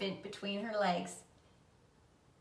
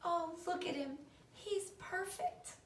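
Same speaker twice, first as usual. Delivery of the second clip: high, soft, slow hushed awe; whispered wonder; 9 semitones higher, 8 dB quieter than usual. A narrator's reading. Fern over the newborn pig, soft hushed awe. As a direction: breathe it out in tender wonder. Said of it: "she whispered".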